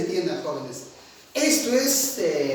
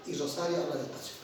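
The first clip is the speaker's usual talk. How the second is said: much lower and much softer, quick; speaking softly; 7 semitones lower, 12 dB quieter, faster than usual. Shown as a demonstration. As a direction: drop the voice right down. (suave y bajito)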